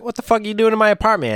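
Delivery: high, fast, loud confrontation; hammed-up indignant shout; acted outraged yell. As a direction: yell at intruders in mock outrage.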